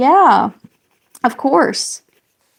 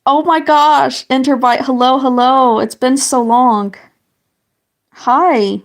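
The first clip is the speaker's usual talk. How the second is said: excited greeting; 3 semitones higher, 6 dB louder than usual. Real, not acted. Greeting a returning chat viewer.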